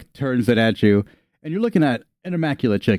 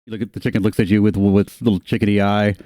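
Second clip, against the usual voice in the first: ordinary talk, nothing marked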